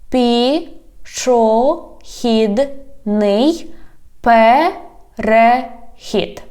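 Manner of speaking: "Пішохідний перехід" very slow, every syllable apart. Shown as a demonstration. Teacher has learners repeat, "Пішохідний перехід" slowly by syllables. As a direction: stretch and separate each syllable of "Пішохідний перехід". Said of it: "divide it into syllables".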